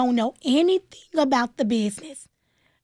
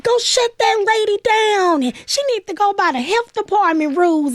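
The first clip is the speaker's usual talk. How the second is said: high, louder mimicry of angry critics; mocking critics; 8 semitones higher, 4 dB louder than usual. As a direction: throw the critics' jeers back in a raised mocking voice. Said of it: "and people like".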